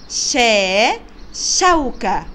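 loud شا on its own, then the word شوكة; sounding out. Shown as a demonstration. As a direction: model how شوكة begins with شا. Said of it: "بيبدأ بصوت شا".

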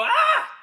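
high startled scream; playful mock fright scream; scared yelp. Shown as a demonstration. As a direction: shriek like you just saw a bear. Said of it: "jump away from your pillow and go".

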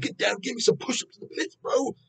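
high, mocking hype-man voice; mock rapper-style frontman. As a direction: parody a singer acting like a rapper. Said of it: "Why are you talking like that?".

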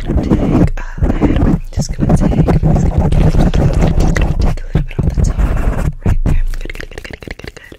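rapid close-mic whispered trigger words; fast aggressive asmr. Acted. ASMR intro, repeated trigger words.

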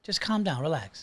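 soft, soothing insistence; quiet and unruffled; placating calm-down. Acted. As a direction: gently talk a worked-up friend down. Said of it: "I want you to say, "Calm down".

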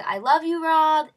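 loud, high fangirl yell; retold scream. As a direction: re-enact screaming out to your idol. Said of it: "So I screamed".

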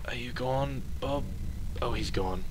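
hushed whisper. Real, not acted. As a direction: whisper it nervously. Checking if ghost is gone.

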